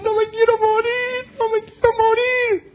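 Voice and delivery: slow, very high acted whine; exaggerated high-pitched pleading; whiny mock wail. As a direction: drag it out like a hammed-up frightened cry. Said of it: "Nosotros decimos".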